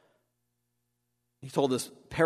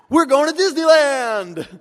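much higher and louder, hammed-up glee; mock cheerful; 14 semitones higher, 10 dB louder than usual. Preacher mimicking a glib planner, giddy and hammed-up.

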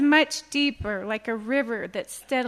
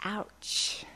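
whispered ouch; 4 semitones lower, 7 dB quieter than usual. Real, not acted. Reacting to stinging quote.

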